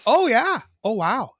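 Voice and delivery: high, loud delighted surprise; excited oh wow. Retold or imitated. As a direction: gasp out a thrilled oh wow. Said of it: "Or there's like".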